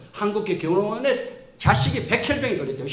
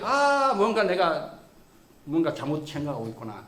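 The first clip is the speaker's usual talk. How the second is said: dawning realization; 5 semitones lower than usual. Retold or imitated. Teacher's realization.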